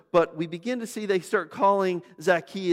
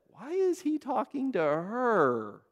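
high, slow incredulous question; mock disciples' shock; 7 semitones higher, slower than usual. Disciples gossip about Jesus and the woman, slow mock disbelief.

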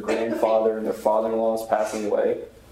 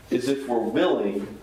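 ordinary talk

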